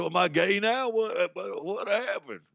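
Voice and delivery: high-pitched comic bewilderment; rising into a silly high wail of confusion; mock bewildered. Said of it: "I said".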